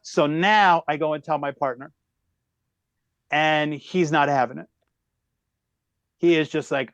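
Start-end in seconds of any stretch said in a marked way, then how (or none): none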